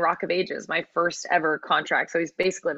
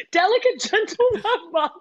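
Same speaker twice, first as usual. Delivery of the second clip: much higher, amused, laughing through the words; 14 semitones higher, 4 dB louder than usual. Real, not acted. Cracking up at own description of a castmate, laughing. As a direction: repeat it in a high laughing voice.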